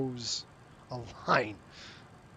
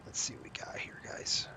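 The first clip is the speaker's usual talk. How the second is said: breathy whisper to himself; 4 semitones lower, 7 dB quieter than usual. Real, not acted. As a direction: whisper it under your breath. Muttering to himself at the screen.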